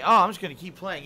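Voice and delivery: mock stubborn. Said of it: "I'm not gonna say".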